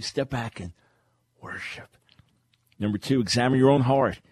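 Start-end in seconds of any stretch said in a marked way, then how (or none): 0.0-2.0 softer, trailing into a whisper on worship; fading to whisper. Real, not acted. Preacher softly urging listeners to worship. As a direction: let it fade to a breath.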